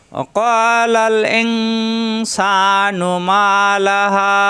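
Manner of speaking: slow melodic chant of the verse; Quran recitation. Shown as a demonstration. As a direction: intone it drawn out.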